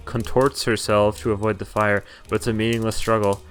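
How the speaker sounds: ordinary talk, nothing marked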